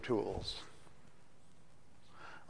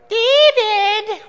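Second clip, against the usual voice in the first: a piercing, much higher and louder call in a mocking harsh Chicago accent; 14 dB louder than usual. Preacher imitating his friend's mother calling from upstairs, harsh Chicago accent. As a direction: bellow the boy's name up the stairs in a caricatured Chicago accent. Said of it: "a very harsh Chicago accent".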